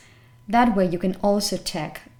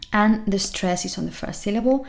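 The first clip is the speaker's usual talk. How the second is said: normal talking voice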